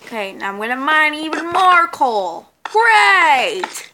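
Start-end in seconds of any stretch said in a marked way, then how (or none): 2.6-3.9 loud, high sarcastic "great". Real, not acted. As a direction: fake cheer, meaning the opposite. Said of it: "That was sarcasm".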